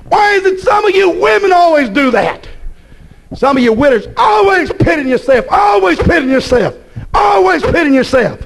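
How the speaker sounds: high shouted rebuke; fiery preaching. Real, not acted. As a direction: call out the widows. Preaching at full force.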